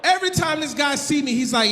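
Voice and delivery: ordinary delivery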